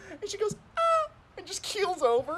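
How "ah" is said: very high imitated cry of pain; mock yelp. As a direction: yelp like a kid who hurt herself.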